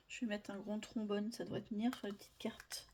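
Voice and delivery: soft whisper to herself. Real, not acted. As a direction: murmur it under your breath. Voice drops to whisper. (chuchoté)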